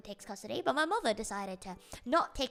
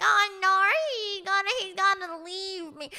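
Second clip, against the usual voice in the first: mock panicked mother; 9 semitones higher, 6 dB louder than usual. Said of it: "my mother was like".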